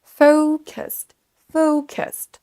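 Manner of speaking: FOH-kəst, focused high and long on FOH; first-syllable stress. Shown as a demonstration. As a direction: punch the first syllable of focused, then drop. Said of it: "un petit peu plus fort et plus longtemps". (focused, première syllabe forte et longue)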